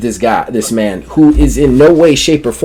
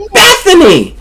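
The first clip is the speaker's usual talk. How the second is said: put-on much higher, louder old lady voice; sweet old lady; 5 dB louder than usual. Old Rebecca greeting Bethany in a high granny voice. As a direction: squeak it like a cheery granny.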